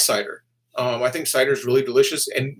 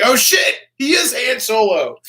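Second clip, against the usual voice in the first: excited realization; 10 semitones higher, 5 dB louder than usual. Retold or imitated. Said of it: "I'm like".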